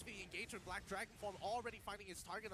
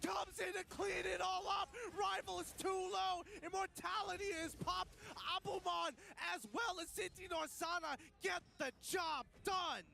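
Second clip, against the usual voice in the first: esports caster; 11 semitones higher, 6 dB louder than usual. Real, not acted. Shouted play-by-play of a fight.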